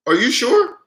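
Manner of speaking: imitated Indian accent. Scammer double-checking the caller's name, imitated Indian accent. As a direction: question it in a put-on Indian accent. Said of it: "you hear that Indian voice".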